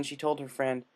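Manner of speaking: usual speaking voice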